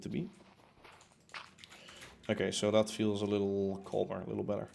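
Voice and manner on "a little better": ordinary talk, nothing marked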